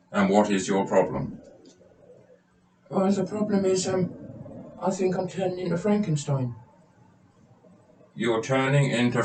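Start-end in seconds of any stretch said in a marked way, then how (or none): 2.9-6.5 much higher, put-on voice of a timid patient. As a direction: play the nervous patient, pitched well up. Patient describes symptom.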